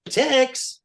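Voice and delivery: high, loud, slow call across the room. Retold or imitated. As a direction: stretch the name out, summoning him. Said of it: "And Paul's like".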